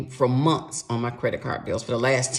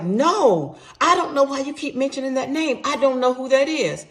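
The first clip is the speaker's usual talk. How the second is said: higher mock denial; raised protesting voice; husband denying; 10 semitones higher, 5 dB louder than usual. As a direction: play the husband insisting he knows nothing.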